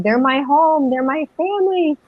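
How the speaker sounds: high, emotional reenacted plea; retold crying. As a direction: act out a tearful recognition, voice lifted. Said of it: "I started crying and I was saying".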